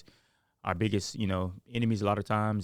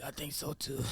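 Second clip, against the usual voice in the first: monster voice; 6 semitones higher, 12 dB quieter than usual. Retold or imitated. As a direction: growl it like a nightmare monster. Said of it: "You sound like the monster in my nightmare".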